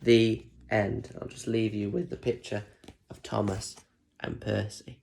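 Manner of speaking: plain reading voice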